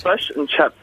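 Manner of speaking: "Fish and chips" with short New Zealand "uh" vowels; New Zealand accent. Shown as a demonstration. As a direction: coach showing a clipped New Zealand accent. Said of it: "that's the way they talk".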